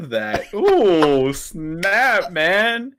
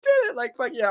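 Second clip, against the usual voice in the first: high, gleeful victory cry; triumphant; faster than usual. Retold or imitated. Recalling the moment she said yes, triumphant and high. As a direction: crow it like you just won. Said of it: "I'm like".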